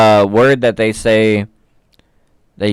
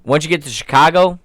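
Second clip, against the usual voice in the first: higher-pitched Chicago regional accent; 4 semitones higher than usual. Shown as a demonstration. Co-host demonstrates regional accents to friend, Chicago accent. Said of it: "the New York fucking Jersey, Boston accent".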